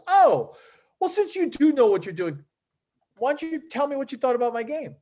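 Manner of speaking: high-pitched imitated "oh"; sudden realization. Player asking a known pro for feedback, sudden realization.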